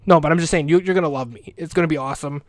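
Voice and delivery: ordinary talk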